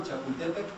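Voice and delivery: Chapultepec with stress on TE; Nahuatl stress. Shown as a demonstration. Teacher asking everyone to repeat, chapul-TE-pec. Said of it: "actual Nahuatl pronunciation".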